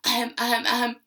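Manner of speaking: series of short ahems; showy warm-up ahem, ahem; mock throat clearing. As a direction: clear your throat for show. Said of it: "Take a moment, clear it out".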